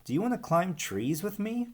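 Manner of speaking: little snake asking a friend to play; Verdi inviting. Acted. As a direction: ask it hopefully.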